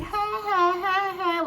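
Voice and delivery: high, sing-song mocking "ha ha"; mock teasing laugh. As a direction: taunt like a kid with fingers in her ears. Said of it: "She's like".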